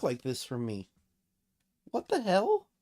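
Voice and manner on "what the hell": baffled outburst. Real, not acted. Software not working.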